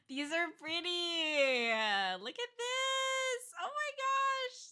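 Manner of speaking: slow squeal climbing high; awed gushing. Real, not acted. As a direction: stretch each phrase into a delighted squeal. Gushing over pretty artwork.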